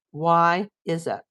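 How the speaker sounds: normal talking voice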